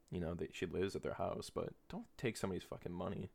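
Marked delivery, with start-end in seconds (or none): none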